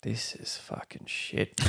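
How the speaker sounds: slow whisper of self-disgust, retold; whispered, defeated aside about your own recordings; muttered frustration. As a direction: breathe it out under your breath, fed up. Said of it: "and I was like".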